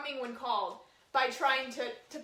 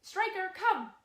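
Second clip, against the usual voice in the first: calling the dog; 7 semitones higher, 4 dB louder, slower than usual. Shown as a demonstration. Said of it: "just randomly go".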